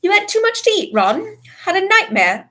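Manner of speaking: high, loud condescending dismissal; pompous prefect. A narrator's reading. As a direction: talk down like a prefect. Percy dismisses Ron.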